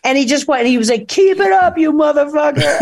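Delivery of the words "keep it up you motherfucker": cheering yell. Retold or imitated. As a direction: egg her on like a rowdy fan. Stranger voiced cheering her on.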